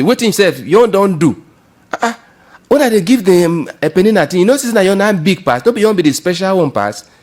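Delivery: loud, high, harsh rebuke; the wrong way for a husband to answer his crying wife; harsh scolding. Pretend. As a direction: snap at her like a careless husband. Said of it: "He no talk am like that".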